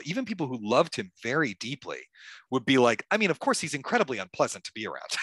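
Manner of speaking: normal talking voice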